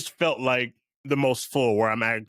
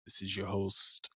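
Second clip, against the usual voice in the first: smooth jazz radio host; 2 semitones lower, 6 dB quieter than usual. Shown as a demonstration. Playing jazz host back from commercial, smooth hushed DJ voice. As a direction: welcome listeners back softly and unhurriedly. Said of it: "if you were on a jazz radio right now".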